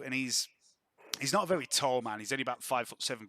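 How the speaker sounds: usual speaking voice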